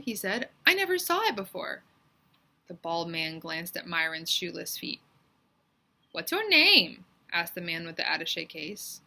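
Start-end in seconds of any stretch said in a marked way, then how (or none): 0.6-1.8 put-on scared boy. Myron denies, high pitched. 6.1-7.0 loud, high stern question; man questions a scared boy, loud and stern; stern demand. Acted. Man demands Myron's name.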